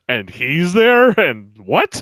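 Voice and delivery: high, loud, drawn-out disbelief; astonished reaction. Retold or imitated. Host reliving his reaction to a game announcement, loudly astonished. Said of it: "I was like".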